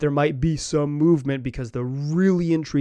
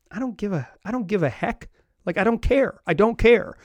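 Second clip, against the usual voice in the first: higher-pitched mock defiance; imagined stubborn brush-off, growing more emphatic; imitated defiant indifference; 5 semitones higher than usual. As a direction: quote him not caring, pitched up, building in force. Imagines player refusing to budge.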